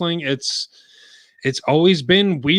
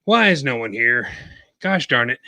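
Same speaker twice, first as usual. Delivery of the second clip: ordinary delivery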